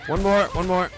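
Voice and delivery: high, loud urgent cheer; excited urging. Real, not acted. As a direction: egg them on, loud and high. Urging on in combat.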